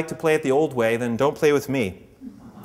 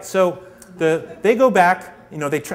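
normal talking voice

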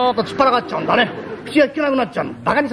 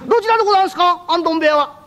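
high, brightly servile asking; a hint of a fawning rogue; chirpy freeloader; 8 semitones higher than usual. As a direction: play the shameless guest politely eager. Freeloader cheerfully follows to lockup.

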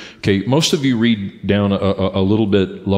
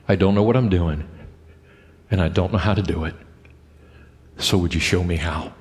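soft, lower, humble prayer; quiet prayer; 5 semitones lower, 4 dB quieter than usual. Acted. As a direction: pray it softly to God. Voicing a prayer to God.

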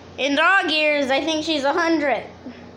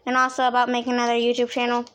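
normal talking voice

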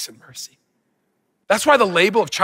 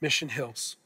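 closing prayer. Acted. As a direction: pray gently and invite stillness. Leads closing prayer and reading.

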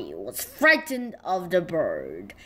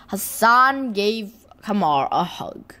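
usual speaking voice

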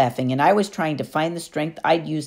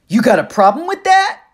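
loud, higher husky sneer; 9 semitones higher, 7 dB louder than usual. Acted. Clarice challenges Percy, loud husky sneer. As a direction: dare him to answer back. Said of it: "Clarice sneered".

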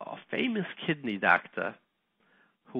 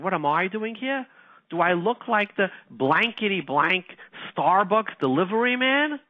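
indignant retort; 5 semitones higher than usual. Retold or imitated. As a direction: fire it back at the nurse, offended. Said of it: "I said".

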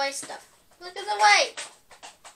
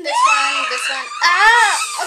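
high laughing shriek, half crying; laughing scream; 11 semitones higher, 14 dB louder, slower than usual. Real, not acted. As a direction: squeal between laughing and crying. Scared of the worst candy.

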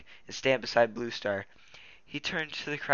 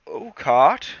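louder rising startled question; shocked gasp; 5 semitones higher, 11 dB louder than usual. Acted. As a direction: Graypaw stunned. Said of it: "Graypaw gasped".

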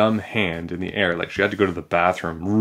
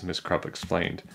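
ordinary delivery, nothing marked

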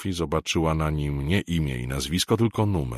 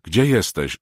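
louder, higher urgent call; 5 semitones higher, 6 dB louder than usual. A narrator's reading. Woman on the roadside calling loudly into a silent phone. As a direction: call out to him across the line. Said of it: "zawołała".

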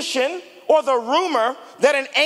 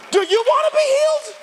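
much higher, pressing plea; climactic question; 12 semitones higher, 4 dB louder, faster than usual. Acted. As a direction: push the question to its peak.

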